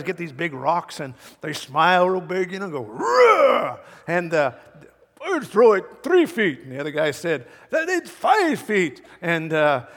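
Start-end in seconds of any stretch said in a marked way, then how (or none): 2.8-3.8 loud, high straining roar; imitated effort roar. Preacher imitating Scottish rock throwers, effort roar. 5.2-6.6 high, loud mock boast; boastful rock thrower. Preacher mimicking Scottish rock thrower, mock boast. 7.7-9.0 high, slow mock boast; rival rock thrower. Preacher mimicking rival Scottish thrower, mock boast.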